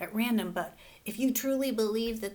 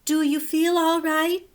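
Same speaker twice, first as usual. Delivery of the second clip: higher, louder worried mother; 7 semitones higher, 5 dB louder than usual. Acted. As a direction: ask it like an anxious mom. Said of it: "she asked".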